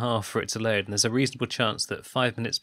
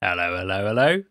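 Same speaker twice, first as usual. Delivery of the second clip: Cockney bobby greeting; 3 semitones lower than usual. Pretend. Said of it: "Cockney policeman accent".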